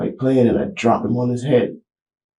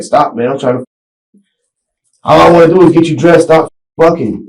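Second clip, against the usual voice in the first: put-on loud, raised yell at a squirming toddler; retold yelling; 5 semitones higher, 8 dB louder than usual. Father yelling at his son to hold still, loud. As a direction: shout it like you've lost patience. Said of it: "I was yelling".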